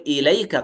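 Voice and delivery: إليك with the kaf said fully and distinctly; clear kaf. Shown as a demonstration. Teacher marking the clear kaf after a sukun. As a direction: sound the final k of إليك clearly, no merging. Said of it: "يقول".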